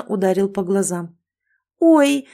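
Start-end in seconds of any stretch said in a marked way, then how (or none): 1.8-2.3 sudden loud startled yelp; startled cry. Acted. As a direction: cry out in surprise. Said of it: "вскрикнула от неожиданности".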